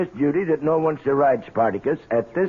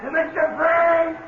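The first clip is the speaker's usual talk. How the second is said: shouting across the corral at Frank; yelled warning; 10 semitones higher than usual. Acted. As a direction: yell him out of the corral. Farmhand yelling warning.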